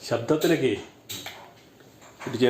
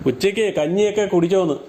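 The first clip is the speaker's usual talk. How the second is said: insistent, raised-pitch repeat; repeating for poor hearing; 5 semitones higher than usual. Real, not acted. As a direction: spell it out word by word for someone hard of hearing. Third try for hard-of-hearing listener.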